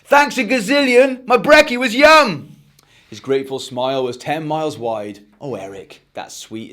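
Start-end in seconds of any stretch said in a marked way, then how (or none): 0.0-2.6 much higher and louder, the last words slower; a kid calling thanks across the house; joyful call to mum in the kitchen; calling out happily. Acted. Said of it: "called to his mum". 5.4-6.7 lower, much softer, tender mum; gently touched mother; mum's voice. Acted.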